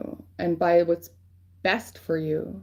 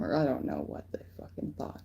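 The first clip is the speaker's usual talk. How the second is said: whisper; 5 semitones lower, 10 dB quieter than usual. Real, not acted. Trails off muttering.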